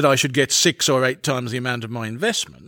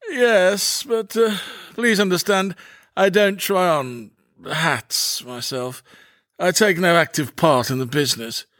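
put-on hesitant, self-important young man; put-on voice of a fussy businessman; Murray Posh; 3 semitones higher, slower than usual. A narrator's reading. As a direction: start high and halting, then slow down.

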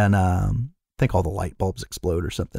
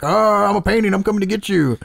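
hammed-up haunted-object voice, pitched way up; mock monster painting; 10 semitones higher than usual. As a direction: play a painting charging in for the scare. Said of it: "charging at Lorraine with the painting, like".

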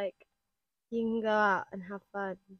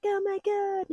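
excited raver; 9 semitones higher than usual. Retold or imitated. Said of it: "you're like".

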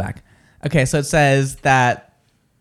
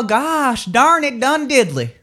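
slow, high, drawn-out mock cussing; mock folksy exasperation; 8 semitones higher, slower than usual. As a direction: stretch out the silly minced oath. Mock folksy swearing after advice.

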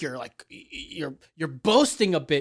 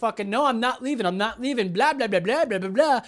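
higher, repetitive imitation of refusal; mocking stubborn rant; 3 semitones higher than usual. Customer refusing to leave the shop, mocking rant. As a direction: rattle off a mock protest into blahs.

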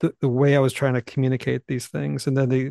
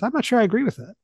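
lightly voiced objector, pitched higher; imitated interrupting listener; 8 semitones higher than usual. Congregant interrupts the preacher mid-sermon, lightly imitated objection. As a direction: pipe up as someone in the pews, higher-pitched. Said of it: "someone raises their hand and says".

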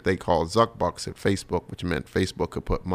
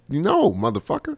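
blunt refusal; 9 semitones higher than usual. Retold or imitated. Refusing the pushy stranger.

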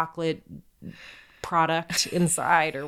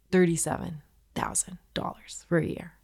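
whispered in disbelief; scandalized whisper. Real, not acted. Host reveals the school's tuition to co-host, whispering. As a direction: lean in and whisper the outrageous number.

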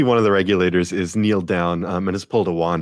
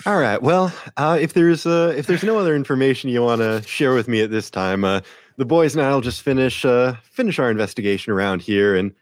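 higher-pitched, courteous officer voice; folksy officer Kara; 6 semitones higher than usual. Acted. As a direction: wrap up politely as a small-town officer. Game master plays the officer Kara.

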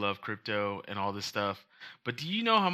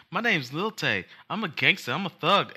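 mock kid gangster; 7 semitones higher than usual. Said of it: "she was like".

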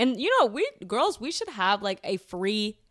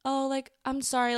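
high-pitched mock schoolgirl apology; rude fake apology; 7 semitones higher than usual. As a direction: imitate a girl who isn't sorry at all. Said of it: "being so rude".

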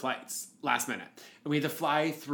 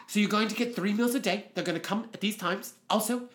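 put-on high-pitched English accent drifting Australian; bad English accent; 6 semitones higher than usual. Events director explaining quarantine meals, shaky English-Australian accent. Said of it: "I think I am going Australian".

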